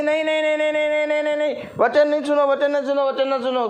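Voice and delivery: high, rapid-fire repeated refusal; refusing spirit. Acted. The old spirit refusing God's word, insistent high refusal. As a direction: chant the refusal on one high note. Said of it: "कहती".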